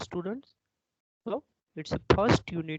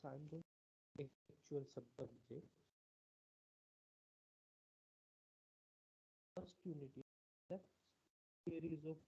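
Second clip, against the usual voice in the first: very faint, far from the mic; speaking far too softly; barely audible; 20 dB quieter, faster than usual. Real, not acted. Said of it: "your voice is very low".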